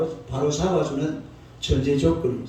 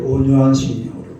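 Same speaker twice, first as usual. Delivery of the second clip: 온유한 심령으로 said louder, 온유한 stressed with a falling end; loud call to repeat; 7 dB louder than usual. Shown as a demonstration. Pastor leading the congregation in repetition, louder call. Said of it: "따라서".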